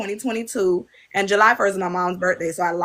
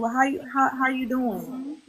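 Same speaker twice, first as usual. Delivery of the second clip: soft, slow, higher-pitched therapist voice; gentle therapist question; 5 semitones higher, 5 dB quieter than usual. Retold or imitated. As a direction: ask gently like a careful counselor. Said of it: "they ask you".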